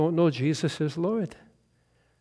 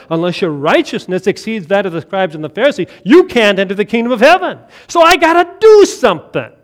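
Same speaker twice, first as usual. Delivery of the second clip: louder and louder, racing faster as the panic builds; rising frantic preaching; 9 dB louder, faster than usual. Acted. Preacher mocking a frantic believer, building to a loud rush. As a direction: drive it up into a near shout of worry.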